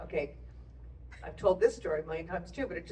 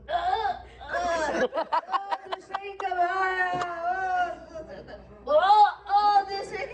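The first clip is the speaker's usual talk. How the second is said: high, stretched-out stammering death rattle, milked for all it's worth; hammy dying gasps; 9 semitones higher, slower than usual. Retold or imitated. Actor playing the dying father gasps his lines over the top. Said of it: "suddenly he goes".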